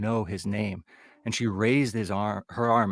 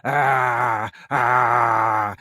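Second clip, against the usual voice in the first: retold scream; 7 dB louder than usual. Old woman on one leg screaming, loud shriek. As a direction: shriek loudly. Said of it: "she began screaming again".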